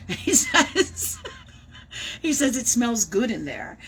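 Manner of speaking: words broken up by real laughter; giggling and half crying; laughing through it. Real, not acted. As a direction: laugh while you pass on the message. Laughing while relaying the message.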